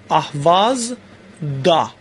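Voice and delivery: اهوازدا drawn out very slowly, syllable by syllable; slow demo. Shown as a demonstration. Teacher slowly models the suffix for students. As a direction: spell out اهوازدا slowly for the class. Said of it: "باید بگم اهوازدا".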